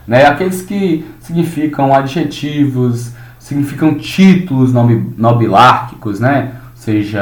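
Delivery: ordinary delivery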